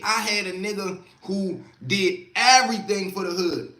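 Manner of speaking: ordinary delivery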